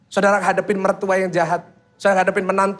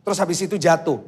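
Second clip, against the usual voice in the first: ordinary talk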